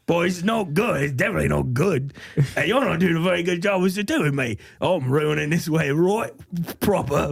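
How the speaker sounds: high pirate-Aussie mash-up; pirate-Australian accent. Acted. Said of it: "half pirate, half crocodile Dundee".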